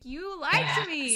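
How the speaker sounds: high-pitched, wounded "You lied to me"; high hurt accusation. Retold or imitated. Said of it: "I was like".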